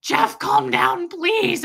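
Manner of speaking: loud, high, drawn-out retold pleading; panicked plea. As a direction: beg him to stop, acting out the panic. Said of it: "guys, my heart, like, I was, like, checking my vitals".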